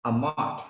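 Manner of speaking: Amat said ah-MAHT; pronounced Amat. Shown as a demonstration. Teacher gives the Hebrew for truth, ah-maht. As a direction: say Amat with an open ah. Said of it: "It pronounced".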